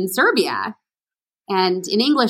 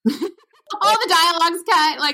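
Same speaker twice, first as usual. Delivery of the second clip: high, drawn-out words through real laughter; laughing; 8 semitones higher, slower than usual. Real, not acted. Actor telling how the dialogue got cut, laughing. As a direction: laugh while saying it, pitched well up.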